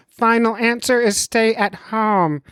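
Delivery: slow, high put-on doctor voice; mock doctor voice. Podcaster reads his doctor friend's verdict, mock doctor voice. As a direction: stretch it out high as a caricatured doctor. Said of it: "I'll do the voice work for him".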